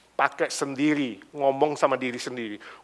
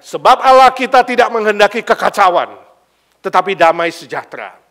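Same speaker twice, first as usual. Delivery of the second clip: forceful preaching; 3 semitones higher, 11 dB louder than usual. Acted. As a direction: drive the point home from the pulpit. Preaching scripture.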